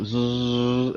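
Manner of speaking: sustained buzzing "zzz"; wire brush buzz. Retold or imitated. Car owner retelling cleaning the valve, buzzing brush noise. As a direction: imitate a wire brush whirring on metal. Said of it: "getting my wire brush on it".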